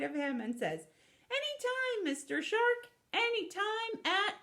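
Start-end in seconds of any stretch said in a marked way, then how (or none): 1.3-4.3 very high, cutesy character voice; sweet eager spider. Acted. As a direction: squeak it out, sweetly obliging. Spider climbs on shark, gushing.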